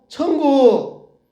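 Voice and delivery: put-on high, loud, drawn-out child's reply; acted small child shouting the answer; kindergartner answering. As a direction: stretch it out like a class in chorus. Children answering the teacher in chorus.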